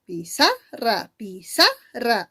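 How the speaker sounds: "pizarra" slowly in syllables, leaning on the middle "za"; drawn-out "pizarra" with the second syllable strongest; stress on SA. Shown as a demonstration. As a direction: test the stress of "pizarra", pushing "za". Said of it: "La mayor fuerza está en sa".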